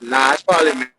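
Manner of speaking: loud, clipping call audio; crackly broken mic sound; distorted audio. Real, not acted. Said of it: "you sound crazy right now".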